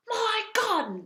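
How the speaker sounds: high, loud shout for his gun; duke shouting. A narrator's reading. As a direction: yell for the gun. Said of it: "the Duke was shouting to the gardener".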